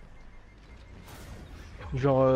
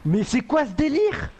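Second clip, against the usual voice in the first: surprised; 8 semitones higher, slower than usual. Real, not acted. Nearly killed, outraged.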